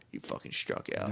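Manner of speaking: very low, hushed mutter; mock self-reproach. A student alone after striking out, mock self-reproach. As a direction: mutter it darkly under your breath.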